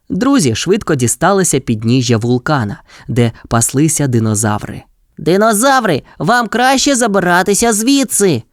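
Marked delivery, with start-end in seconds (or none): 5.2-8.4 high-pitched call, no real volume; megaphone shout. A narrator's reading. Chase warns dinosaurs by megaphone, mock shout. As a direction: call a warning across, voice lifted. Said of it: "крикнув гонщик у мегафон".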